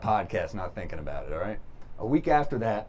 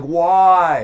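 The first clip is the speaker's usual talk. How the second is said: loud, high mock wail; hammed-up anguish; 7 semitones higher, 11 dB louder than usual. Friend huffing over his wrecked car, mock anguished wail. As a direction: cry it out in exaggerated dismay. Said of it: "He's huffing and puffing".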